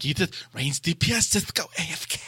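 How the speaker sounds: fussy effeminate raid leader, lightly lisped; mock prissy command with a lisp; lisping drama queen. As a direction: order them off like a miffed diva. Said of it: "He did use that, the whole A F K thing".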